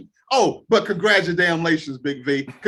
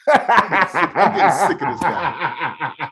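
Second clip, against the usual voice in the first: laughing; 3 semitones lower, 3 dB louder, much slower than usual. Real, not acted. Laughing repeat of Saban quote.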